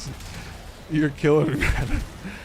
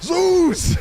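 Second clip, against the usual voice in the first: yelled much higher and louder; excited shout; 6 dB louder than usual. Real, not acted. Streamer shouting the other god's name, loud and high. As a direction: belt out the name. Shouting god names while playing.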